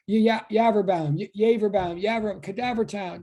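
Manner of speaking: stumbling playfully over YAVERBAUM; mock stutter. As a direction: stutter on the start of YAVERBAUM on purpose. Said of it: "I have to stutter over my own too".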